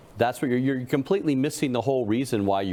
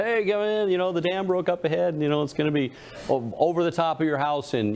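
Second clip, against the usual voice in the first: urgent rescuer call; 7 semitones higher than usual. Retold or imitated. Rescuer in the flood joke.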